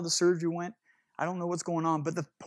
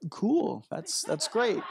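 doubtful; 6 semitones higher, much slower than usual. Retold or imitated. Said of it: "you can tell that look of doubt".

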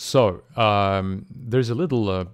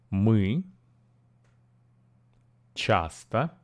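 each chunk said slowly with pauses to repeat; slow phrase-by-phrase drill; teacher's chunked model; part by part; slower than usual. Shown as a demonstration. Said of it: "break this sentence in parts and repeat".

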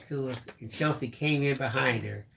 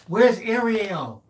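high, drawn-out imitation of her question; daughter calling out; 8 semitones higher, 5 dB louder, much slower than usual. Father imitates daughter on the phone, calling out.

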